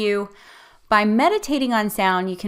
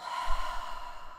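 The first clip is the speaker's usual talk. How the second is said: soft exhale sigh; 9 dB quieter than usual. Shown as a demonstration. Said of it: "relax on your exhale".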